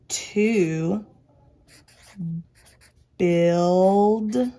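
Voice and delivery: each word stretched, with long pauses between; very slow, sound out every word for writing; word-by-word dictation. Shown as a demonstration. Teacher dictating a sentence to pupils, word by word, drawn out.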